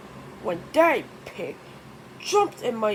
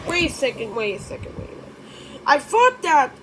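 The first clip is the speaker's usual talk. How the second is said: plain reading voice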